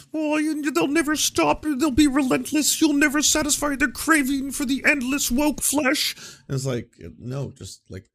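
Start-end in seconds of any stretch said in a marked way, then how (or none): 0.1-6.1 high, loud, strained Peterson voice; mock Jordan Peterson impression. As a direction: overdramatic prophet of doom, slow. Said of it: "Jordan Peterson was like".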